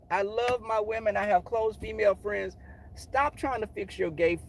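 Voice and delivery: ordinary talk, nothing marked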